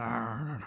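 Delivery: low, quiet rolled "arr"; weary mock growl; low growled grunt. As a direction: grumble out a drawn "arr". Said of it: "rolls his eyes and goes".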